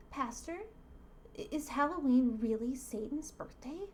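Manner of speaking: put-on hesitant child asking, pitched a bit up; little kid voice. Boy asking his pastor, little kid voice. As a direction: put on a small boy's questioning voice. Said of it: "the kid now is talking to the pastor".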